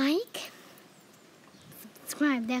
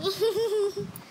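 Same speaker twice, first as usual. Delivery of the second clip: higher, giddy villain giggle; high gleeful giggle; 7 semitones higher than usual. Pretend. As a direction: giggle at your own scheme. Villain laugh after the taunt.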